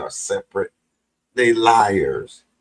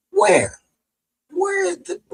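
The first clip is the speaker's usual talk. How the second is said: indignant challenge. Real, not acted. Challenging the claim.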